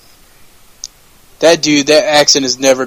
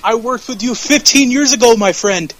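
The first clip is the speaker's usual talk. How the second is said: higher-pitched mock Canadian promo; Canadian accent; 7 semitones higher than usual. Co-host as Owens addressing Sami Zayn, Canadian accent. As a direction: imitate a Canadian wrestler's accent, raised in pitch. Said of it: "you can't cut away the accent".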